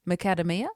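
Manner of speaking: "Macadamia" said "muh-KAD-uh-mee-uh", questioning; "Macadamia" with a short "a", rising at the end; mum's odd pronunciation. Retold or imitated. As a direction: offer "Macadamia" stressed on "KAD". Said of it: "she says".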